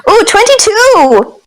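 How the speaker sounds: high, slow thrilled ooh; excited roll. Real, not acted. Player announcing a high dice roll, thrilled. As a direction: savour the good roll.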